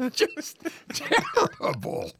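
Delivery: high wheezing burst of laughter; loud guffaw. Real, not acted. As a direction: laugh hard through the words. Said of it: "laugh in a guffaw like I just did".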